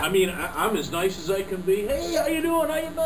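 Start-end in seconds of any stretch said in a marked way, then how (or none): 1.9-2.9 much higher, over-bright fake cheer; fake friendly greeting. Salesman greeting a client he dislikes, fake cheerful. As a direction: put on a salesman's fake smile. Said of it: "It's a, it's a fake smile".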